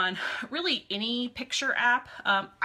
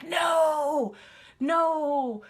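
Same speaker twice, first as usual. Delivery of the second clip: loud, higher yell of protest; 7 semitones higher, 5 dB louder than usual. Retold or imitated. Yelling at the computer screen over a spammy message. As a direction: shout no at the screen. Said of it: "I started yelling".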